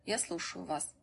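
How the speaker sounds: soft, meek reply; quietly deferential; submissive. A narrator's reading. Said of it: "он покорно ответил".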